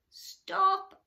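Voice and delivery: high, loud sharp order; command. Acted. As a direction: halt everyone at once. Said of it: "she commanded".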